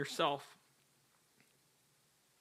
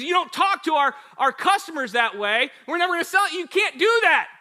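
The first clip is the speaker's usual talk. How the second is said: loud, indignant scolding shout; angry shop owner; 10 semitones higher, 11 dB louder than usual. Retold or imitated. Shop owner scolding the rude parrot, loud angry shout. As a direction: bark it at the parrot like a furious owner.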